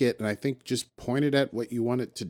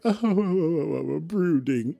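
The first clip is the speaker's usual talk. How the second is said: ordinary talk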